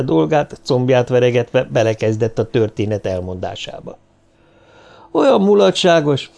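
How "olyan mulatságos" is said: higher-pitched cry of amusement; lively, amused outburst; amused exclamation. A narrator's reading. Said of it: "kiáltott fel".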